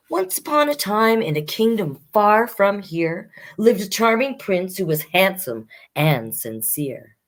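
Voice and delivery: lively rhyming read-aloud; reading a rhyming fairy tale to children; storybook narration. Acted. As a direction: tell the fairy tale to young listeners. Reading Prince and Knight aloud.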